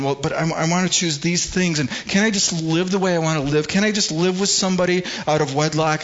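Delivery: mock a young person begging for exceptions; whiny young person. Pastor imitating a young single asking permission, whiny. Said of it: "they're, like, going".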